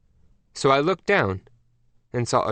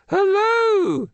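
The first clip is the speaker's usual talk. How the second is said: very high, louder sing-song hello; chirpy queen hello; 5 dB louder than usual. Acted. The Queen rings Mac back, trilling hello. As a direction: trill the greeting.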